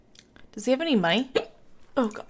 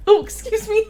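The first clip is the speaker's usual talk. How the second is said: high squeaky hiccup break; 13 semitones higher, 7 dB louder than usual. Real, not acted. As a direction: voice jumps on a hiccup. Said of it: "I have the hiccups".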